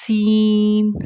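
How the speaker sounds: سین with a whistled s, held very long; loud, long, whistling سین. Shown as a demonstration. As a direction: hiss the s of سین like a whistle, stretch it. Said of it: "وسل sound آتی ہے".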